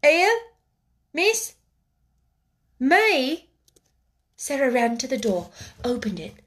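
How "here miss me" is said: high, halting one-word questions; voice lost in astonishment. A narrator's reading. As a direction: squeak each word out in disbelief. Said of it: "Becky lost her voice again".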